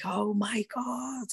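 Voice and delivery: soft, high, drawn-out amazement; awed disbelief. Retold or imitated. Fighter hearing of her bonus, hushed awed disbelief. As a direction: stretch it out quietly, stunned by the news. Retelling reaction to bonus news.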